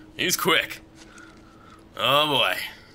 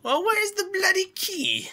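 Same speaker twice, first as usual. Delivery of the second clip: silly falsetto; slower than usual. Pretend. Repeats the key line in a silly voice.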